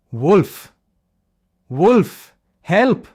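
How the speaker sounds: high, loud desperate scream for help; this time he means it; terrified cry. Acted. Leo facing a real wolf, screaming in true fear.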